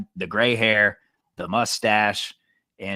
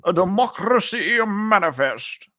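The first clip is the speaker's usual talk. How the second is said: high, slow, grandiose proclamation; viral clip impression; 11 semitones higher, 4 dB louder, slower than usual. Co-host quoting the arrested man, high grandiose indignation. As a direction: declaim it like an outraged orator.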